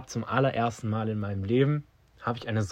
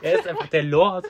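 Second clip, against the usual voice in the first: laughing; 6 semitones higher than usual. Real, not acted. Still laughing about the mayor.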